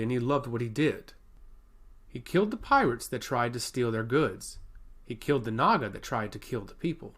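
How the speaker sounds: ordinary delivery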